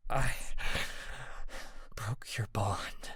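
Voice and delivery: slow rasped whisper. A narrator's reading. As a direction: breathe it out with a rasp. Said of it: "I rasped".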